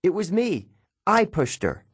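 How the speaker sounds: high-pitched, lightly acted confession; yelping. A narrator's reading. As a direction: blurt it out high to cover for a friend. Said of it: "Grover yelped".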